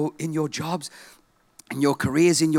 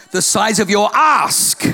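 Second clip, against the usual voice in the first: shouted emphasis; 2 semitones higher, 6 dB louder, slower than usual. Shown as a demonstration. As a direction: shout "ask" slowly with a laugh. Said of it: "I did say ask".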